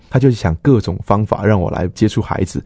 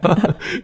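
embarrassed laugh; 11 semitones higher than usual. Real, not acted. Self-mocking joke about children.

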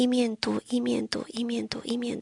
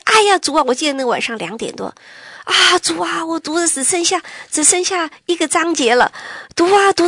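much higher and louder, bursting with joy; joyful excitement; 7 semitones higher, 7 dB louder than usual. Real, not acted. To her congregation, reliving late night joy, excited and loud. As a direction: let the joy of nearly finishing ring out. Joy near the end of the Bible.

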